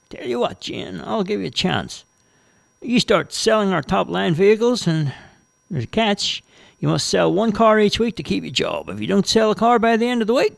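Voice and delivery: deal-making manager setting terms; lightly voiced car dealer boss. Manager Robbo offers the job.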